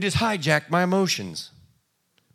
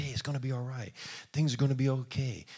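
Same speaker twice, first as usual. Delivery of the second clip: much softer, gentle calming words; soothing reassurance; 3 semitones lower, 8 dB quieter, faster than usual. Retold or imitated. You comforting a panicking loved one, gently soothing. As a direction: ease a panicking friend down. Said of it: "you go over there and you say".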